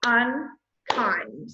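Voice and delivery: UNKIND very slow, UN, long pause, KIND; two separate syllables. Shown as a demonstration. Teacher showing a two syllable word, UNKIND split in two. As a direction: break UNKIND widely between UN and KIND. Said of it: "our two syllable word".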